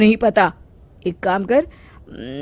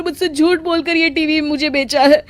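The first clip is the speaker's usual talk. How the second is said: panicked wail; 8 semitones higher than usual. Acted. Mechanic panicking before the policeman, much higher and wailing. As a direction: wail in panic and beg the officer. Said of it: "Babban घबराकर जवाब देता है".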